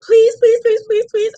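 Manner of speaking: high, loud pleading; whiny beggar voice; mock begging. Imitating a parent begging for supplies.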